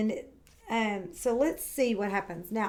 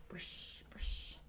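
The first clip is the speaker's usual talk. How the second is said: whisper; 17 dB quieter than usual. Acted. Brushing sound in story.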